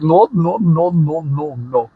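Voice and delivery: high, building string of refusals, easing at the end. Retold or imitated. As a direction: imitate someone escalating his protests. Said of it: "he gets louder".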